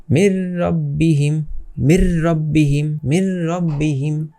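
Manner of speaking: মির রব্বিহিম slow, n merged into a doubled r; merged without ghunnah. Shown as a demonstration. Teacher models idgham, মির রব্বিহিম slow with doubled r, no hum. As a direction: run মির straight into a strong rolled r in রব্বিহিম. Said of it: "এটাকে আমরা কিভাবে মিলিয়ে পড়বো".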